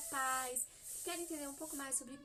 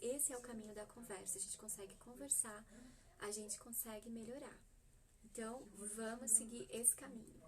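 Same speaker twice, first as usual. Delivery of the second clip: gentle calm mother; 3 semitones lower, 7 dB quieter than usual. Retold or imitated. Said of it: "Eu falo".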